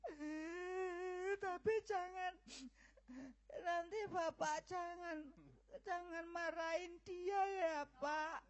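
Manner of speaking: high, soft, sobbing entreaty; crying plea. Acted. Daughter begs her father not to be angry, crying. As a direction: beg through tears not to hurt him.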